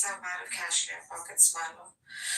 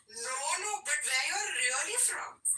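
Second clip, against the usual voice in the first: driver insisting; 7 semitones higher than usual. Retold or imitated. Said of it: "I speak back in their local language".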